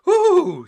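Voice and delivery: high, loud ooh; gasp at the cold. Acted. Mummy gasping as she dives into the cold sea, high ooh. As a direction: gasp at icy water. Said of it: "she gasped".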